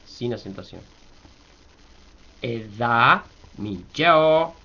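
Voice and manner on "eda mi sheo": "Eda mi sheo" slow, rising on "da" and "sheo"; slow teaching model of "Eda mi sheo"; marked accents. Shown as a demonstration. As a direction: draw out "Eda mi sheo", lifting "da" and "she". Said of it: "paso a paso la acentuación".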